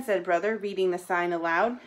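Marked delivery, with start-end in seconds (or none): none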